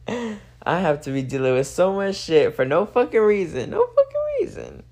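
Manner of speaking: real exasperated outburst; exasperated emphasis. Real, not acted. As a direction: vent it, punch the last words. Venting, peaks on no reason.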